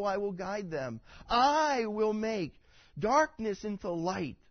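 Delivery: fiery preaching of the prophecy; preaching at full force. Acted. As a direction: boom out God's declaration. Proclaims God's words from Isaiah.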